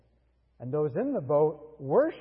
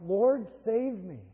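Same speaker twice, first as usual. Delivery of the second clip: mild cry out; 6 semitones higher, slower than usual. Shown as a demonstration. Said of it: "we cry out".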